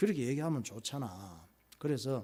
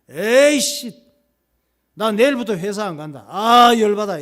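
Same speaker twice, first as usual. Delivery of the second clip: 화난 남편의 버럭 고함; angry husband; 6 semitones higher, 15 dB louder than usual. Acted. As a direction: shout it out, fed up and furious. Angry husband quitting job. (퇴근한 남편이 아내에게 버럭 화내며)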